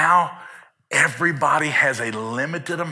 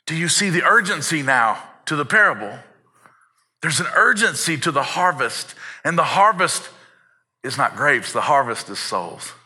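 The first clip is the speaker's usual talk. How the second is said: ordinary talk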